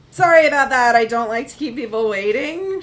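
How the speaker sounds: bright, loud and high with rising ends; uptalk. Acted. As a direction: nurse rushing back in, apologizing with loud uptalk. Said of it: "she uptalks".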